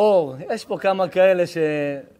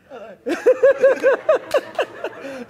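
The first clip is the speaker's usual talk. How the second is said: much higher, breaking into laughter; 3 dB louder than usual. Real, not acted. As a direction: crack up at the answer. Joke with audience member. (צחוק גבוה ומתגלגל)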